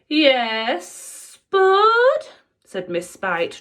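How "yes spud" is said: witchy teacher, high, loud and stretched; drawn-out gloating. A narrator's reading. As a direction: stretch each word, relishing it. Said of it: "drawing out her words as long as possible".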